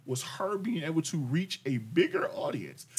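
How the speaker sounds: ordinary delivery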